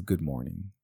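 soft, low villain imitation; Hannibal Lecter. Giving the killer's real greeting, in a low, soft imitated voice. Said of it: "All he says is".

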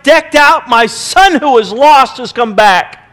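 loud, high commanding shout of joy; joyful shouting. Retold or imitated. Father shouting orders to servants for the feast, joyful and loud. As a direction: call the servants to celebrate, shouting. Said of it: "starts screaming".

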